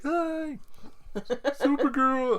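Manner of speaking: high, slow excited fan squeal; fanboy squeal. Pretend. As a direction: call out to your idol like a starstruck fan. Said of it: "you would see me in the background like".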